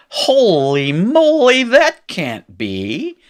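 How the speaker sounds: high, loud, drawn-out shocked exclamation; mock disbelief. Teacher voicing Hebrew's shock at the word form, hammed-up disbelief. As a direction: stretch it out in hammed-up astonishment. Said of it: "looks at this and says".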